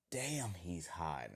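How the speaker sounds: slow, soft, drawn-out admiration; quietly smitten, lingering on the words; smitten inner thought. Acted. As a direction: stretch it out under your breath, admiring. Tamari's admiring thought, voiced.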